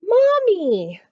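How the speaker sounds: high, loud call for mommy; crying out. A narrator's reading. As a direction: shout for mommy across the sky. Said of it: "cried the strange little creature".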